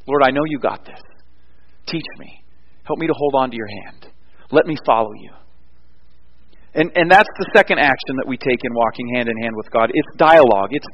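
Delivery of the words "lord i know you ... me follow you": soft, intimate prayer; hushed prayer. Acted. Believer asking the Lord to lead by the hand, hushed prayer. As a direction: pray it quietly, almost under the breath.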